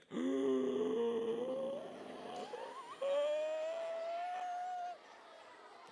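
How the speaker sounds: very high, long drawn-out squealing gasp; huge shocked gasp. Acted. President learning he bought all of it, endless shocked gasp. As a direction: hold an absurdly long gasp of disbelief.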